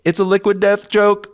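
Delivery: high silly dimwit voice; goofy high voice. Pretend. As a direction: play a bubble-addled goof. Goofy voice about bubbles.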